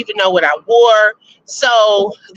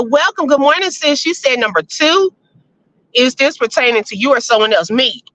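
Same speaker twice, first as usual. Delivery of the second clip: ordinary talk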